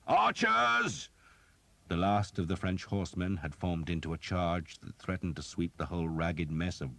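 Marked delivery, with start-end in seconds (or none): 0.1-1.1 high, loud call to the archers; shouted command. A narrator's reading. The Earl calling his archers, a loud high shout. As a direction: bellow for the bowmen. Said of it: "the Earl shouted".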